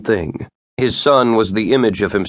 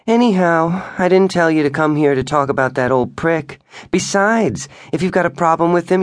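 higher, airy wife's voice, a touch defensive; voicing the wife; 7 semitones higher than usual. A narrator's reading. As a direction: play her as put-upon and brittle. Narrator voices wife Tiff.

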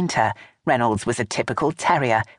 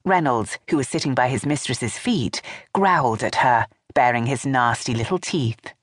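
ordinary delivery, nothing marked

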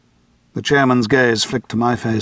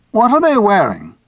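higher, louder curious chairman; 9 semitones higher, 4 dB louder than usual. A narrator's reading. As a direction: probe gently but urgently. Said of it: "the chairman asked".

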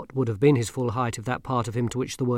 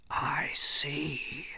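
grim reaper voice; 3 semitones higher, 8 dB quieter than usual. Acted. Death offended.

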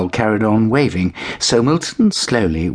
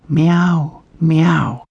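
grateful meow; 9 semitones higher than usual. A narrator's reading. Kitten thanking the girls. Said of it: "said Milton in thanks".

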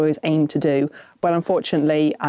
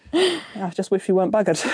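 ordinary delivery

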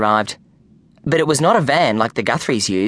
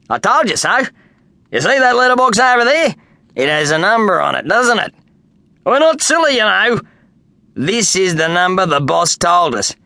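higher, louder cheerful workman; confident Kenny; 5 semitones higher, 3 dB louder than usual. A narrator's reading. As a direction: insist brightly he has it right. Kenny voiced in story.

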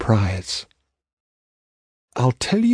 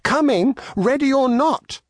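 calling out; 11 semitones higher than usual. Acted. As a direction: sing it out to the hiding animals. Charlie shouted.

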